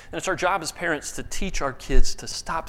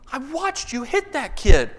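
raised, higher accusing voice; stern accusation, pitched up; accusing teacher; 7 semitones higher, 5 dB louder than usual. Retold or imitated. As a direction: call the kid out, loud and high.

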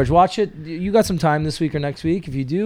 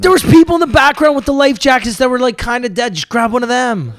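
high-pitched, worked-up protest; comic exasperation at an obvious answer; exasperated outburst; 11 semitones higher, 5 dB louder than usual. Acted. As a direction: burst out incredulous, voice way up high. Exclaims in debate over the door.

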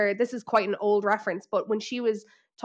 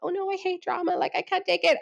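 high, fluttery mock whine; mock Kyle voice; 9 semitones higher than usual. Mocking Kyle pretending she hates drama. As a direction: simper it like a drama-hating diva. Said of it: "someone like Kyle that is like".

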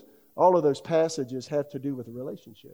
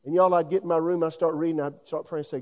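ordinary talk

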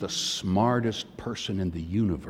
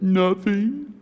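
put-on sniffly little girl; 8 semitones higher than usual. Crying daughter answering her father.

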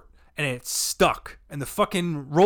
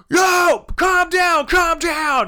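high, loud crowd-control yell; commanding shout; 11 semitones higher, 7 dB louder, much slower than usual. Retold or imitated. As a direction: imitate a manager shouting down a crowd. Manager quiets an angry crowd.

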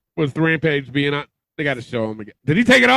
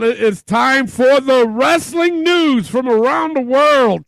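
slow, higher announcer voice; announcer intro; 10 semitones higher, slower than usual. Acted. Host kicking off the wrestling news, slow announcer voice. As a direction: boom out the segment intro like a ring announcer.

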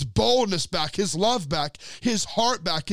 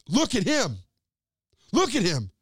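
accusing bystander; 3 semitones higher than usual. Acted. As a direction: point him out to the crowd.

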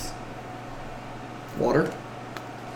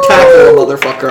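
high, fast excited shout; 6 dB louder, faster than usual. Acted. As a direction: yell it out, fired up. Game master calling out the tackle.

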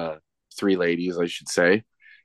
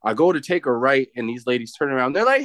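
usual speaking voice

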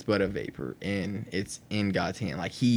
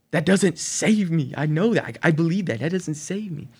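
high, raised acted objection; exasperated retold protest; 9 semitones higher, 5 dB louder than usual. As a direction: argue with God, hammed up. Acting out exasperated prayer.

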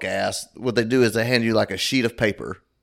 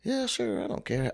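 offhand reply; 4 semitones higher, 8 dB quieter than usual. Retold or imitated. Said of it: "I was like".

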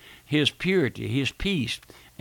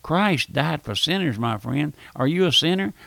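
normal talking voice

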